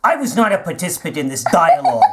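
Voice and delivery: normal talking voice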